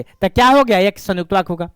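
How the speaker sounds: ordinary talk, nothing marked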